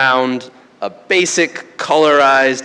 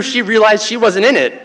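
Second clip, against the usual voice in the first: usual speaking voice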